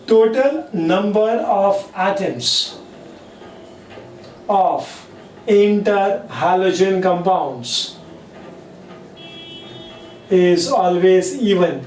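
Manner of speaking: slow, deliberate dictation with long pauses; teacher dictating the rule to students. Shown as a demonstration. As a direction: say each chunk slowly for note-taking. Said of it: "teacher dictating the rule".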